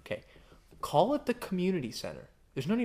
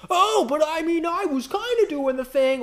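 high, whiny mock excuse voice; mocking lawbreakers making excuses; 13 semitones higher, 6 dB louder than usual. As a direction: play the guilty dodger, high and whining.

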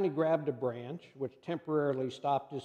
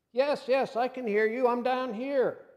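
eager relieved reply; 7 semitones higher than usual. Acted. Jack answers from the cliff.

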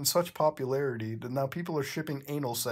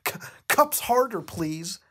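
begging Bendy; 5 semitones higher, 4 dB louder than usual. Acted. Reader voicing Bendy pleading with Cuphead, high mock squeal. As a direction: squeal the request then trail off.

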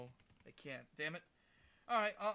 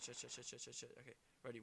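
ordinary talk, nothing marked

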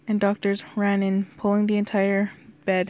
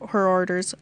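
usual speaking voice

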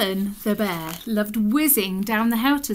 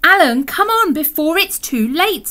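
loud, higher-pitched urging; urgent hurrying; 8 semitones higher, 10 dB louder than usual. Acted. Giraffe hurrying Alan to the toilet, urgent. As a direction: chivvy him along loudly.

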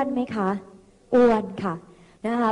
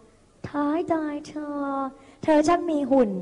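drawn-out mock admiration from a friend; complimenting friend; 2 semitones higher, slower than usual. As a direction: play the friend admiring someone's figure, slowly. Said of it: "เวลาเพื่อนมาชมเชยเรา". (แกล้งทำเสียงเพื่อนชมแบบลากเสียง)

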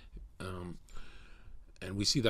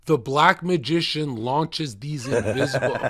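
laughing while reading; 3 semitones higher, 8 dB louder than usual. Real, not acted.

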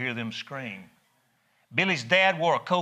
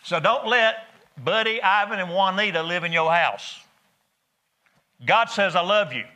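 higher and louder preacher's call; rising preaching; 6 semitones higher, 4 dB louder than usual. Real, not acted. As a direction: build the appeal up. Preacher raising his voice.

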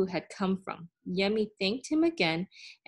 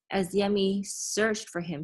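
plain reading voice

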